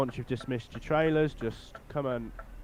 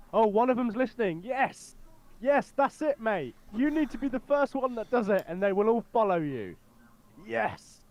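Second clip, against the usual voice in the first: excited cheering; 10 semitones higher than usual. Real, not acted. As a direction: cheer them on with glee. Cheering convoy finally follows.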